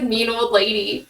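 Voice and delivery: ordinary delivery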